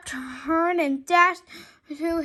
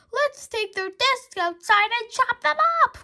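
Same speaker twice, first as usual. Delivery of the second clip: higher, louder gleeful call; eager cry; 7 semitones higher, 5 dB louder than usual. A narrator's reading. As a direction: gloat over the boys. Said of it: "cried Miss Lovell".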